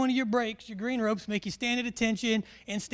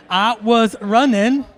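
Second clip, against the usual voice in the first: loud, slow movie-quote voice; Forrest Gump; 11 dB louder, slower than usual. Acted. Briefer echoing an audience member's favorite part, Forrest Gump impression. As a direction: belt it out with drawn-out words. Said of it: "Who's ever seen Forrest Gump?".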